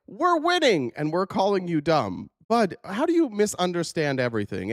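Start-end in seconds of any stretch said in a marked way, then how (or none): 0.1-0.9 high, hammed-up parroted victory cry; mocking gloat. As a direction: chirp it like a smug fan. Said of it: "You guys are going".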